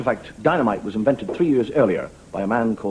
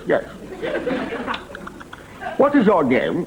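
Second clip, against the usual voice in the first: higher-pitched commanding officer caricature; upper-class officer; 11 semitones higher, slower than usual. Acted. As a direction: play a plummy British CO. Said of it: "people who talk like that".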